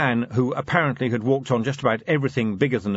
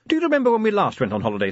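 chatty lady; 10 semitones higher, 3 dB louder, much faster than usual. A narrator's reading. Sybil chatters to husband.

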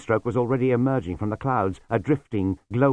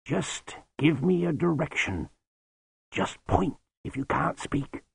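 gentle; 6 semitones higher than usual. Acted. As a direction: coax the boy gently. Lutzer voiced gently.

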